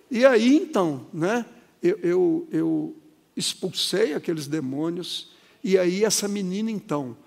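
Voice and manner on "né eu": ordinary delivery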